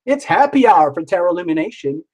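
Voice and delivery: usual speaking voice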